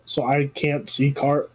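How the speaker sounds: normal talking voice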